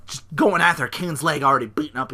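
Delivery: ordinary talk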